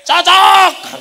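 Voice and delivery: high, very loud shout; explosive retold shout; sudden yell. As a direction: blurt it out at full voice. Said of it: "Saya langsung teriak".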